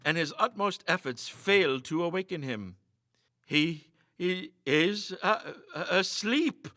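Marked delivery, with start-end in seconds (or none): 3.4-6.7 halting, broken stammer, slow. A narrator's reading. Friend stammers that the men sleep. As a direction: stumble over each word in shock. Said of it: "he stammered".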